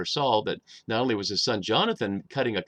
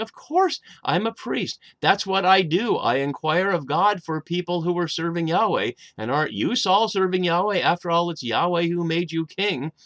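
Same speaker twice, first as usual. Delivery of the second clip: higher and louder, eager self-justifying priest; insistent priest pleading; 6 semitones higher, 4 dB louder than usual. Acted. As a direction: argue it out like a priest defending himself to the king. Voicing the priest's defense to Saul.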